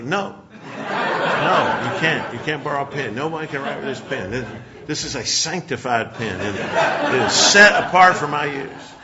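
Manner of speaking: hammed-up possessive refusal through laughter; mock refusal, laughing. Preacher telling churchgoers nobody borrows his sanctified pen, laughing mock-stern.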